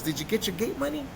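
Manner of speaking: high-pitched keen question; imitated eager friend. Friend asks after the gate money, eager. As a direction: put on a buddy's keen phone voice.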